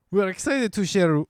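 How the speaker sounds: high-pitched mock French butler. Reading the season news out, French butler voice. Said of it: "I can't, like French butler".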